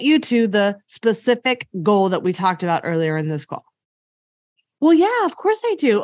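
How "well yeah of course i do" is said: bright, higher eager yes; eager client. Retold or imitated. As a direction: answer like a convinced client, a touch louder. Voicing client's eager answer.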